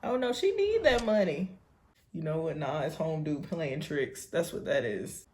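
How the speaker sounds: ordinary delivery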